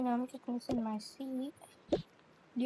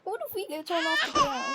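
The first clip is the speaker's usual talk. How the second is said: higher, half-crying pretend panic; mock scared cry; 10 semitones higher than usual. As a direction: wail it like a panicked kid. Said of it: "I'm so scared".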